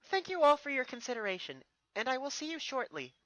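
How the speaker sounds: put-on high, gracious young princess; grateful princess thanking her subjects; Twilight's voice. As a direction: address the crowd kindly and brightly. Twilight's speech to crowd.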